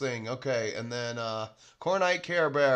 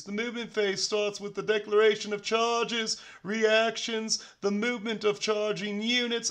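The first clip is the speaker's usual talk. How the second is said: higher-pitched British announcer-style read; put-on British accent; 6 semitones higher than usual. Host reading game rules to stream viewers, mock British accent. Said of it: "Should I do my British accent for this?".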